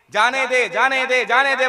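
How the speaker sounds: loud repeated let me go; insistent guru. Acted. (ज़ोर से बार-बार जाने दे)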